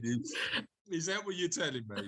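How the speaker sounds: ordinary talk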